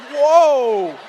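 loud, falling "whoa"; mock overwhelmed reaction; startled. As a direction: recoil from it with a drawn-out whoa. His startled reaction to the squeal.

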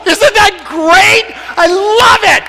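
high, drawn-out excited shout; exultant. Real, not acted. Telling the audience he's Uganda's consul, exultant shout. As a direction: revel in it, stretching the key words high.

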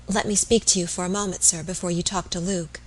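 plain reading voice

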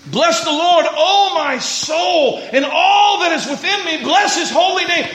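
high, emphatic psalm proclamation; fervent declaiming. Acted. Preacher declaiming the psalm to the church, fervent. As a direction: proclaim it with full fervour.

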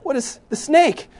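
high, loud mock amazement; imitating rapt kids. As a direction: exclaim it like a child drawn into the story. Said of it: "they're just staring at me".

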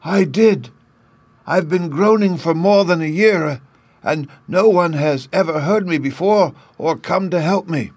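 high, plaintive lament of a tin man; sad Tin Man. A narrator's reading. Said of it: "the sad voice in which the man spoke".

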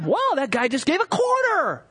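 high, excited gasp at a quarter; hammed-up wow from the pew; mock amazed onlooker. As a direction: gush like an impressed bystander. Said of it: "It's like".

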